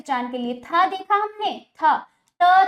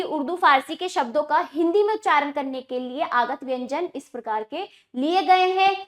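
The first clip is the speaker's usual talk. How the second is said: normal talking voice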